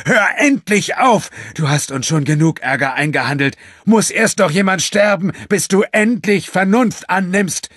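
high, loud roar; bellowing. A narrator's reading. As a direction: bellow at her to stop. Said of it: "brüllte er".

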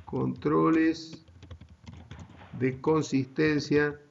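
drawn-out words with long pauses; slow, deliberate dictation. Real, not acted. Teacher introducing a key term to students, slow and deliberate. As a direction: set out the term word by word, slowly.

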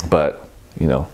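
talking mid-sip; words spoken into a glass; speaking with glass at lips. Real, not acted. Seen 0: Coupe glass held at lips throughout, tasting between words.

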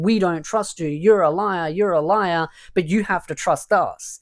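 ordinary talk, nothing marked

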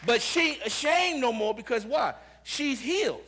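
loud, high-pitched preaching; prédica enérgica. Acted. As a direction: preach it out at full force to the congregation. Preaches at full force.

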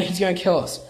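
usual speaking voice

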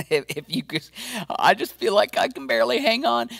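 high, strained voice of someone barely coping; mock the believer who can barely hang on; weary believer. Pastor voicing a worn-out believer, high and strained.

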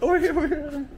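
calling out across the yard; calling from far away. Real, not acted. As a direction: call out, laughing, from a distance. Calling a pet outdoors.

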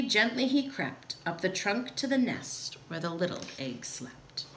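fading to a near whisper; gentle hush. A narrator's reading. Narrator as Horton creeps to the sleeping egg, gentle hush.

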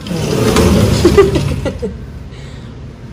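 breathy, wordless burst of laughter; laughing fit. Real, not acted. Mother amused by child's restaurant game, laughing. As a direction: crack up and let it trail away.

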